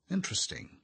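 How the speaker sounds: wearily polite single word, starting high and falling; weary. A narrator's reading. The doctor answering Arthur, weary. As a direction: humour him with tired interest. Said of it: "The doctor sounded weary".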